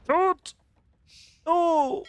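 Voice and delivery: sudden high yelp of dismay; real shocked shout, very high; dismayed cry. Real, not acted. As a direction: cry out as the fight goes wrong. Caster reacts to lost fight.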